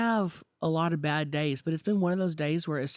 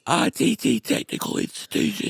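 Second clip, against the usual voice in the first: higher, put-on character voice; Sue Dillon impression; 5 semitones higher than usual. Learner copying Sue Dillon for cohosts, high put-on voice. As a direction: copy the character, pitched up. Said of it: "teach Chelsea how to talk like that".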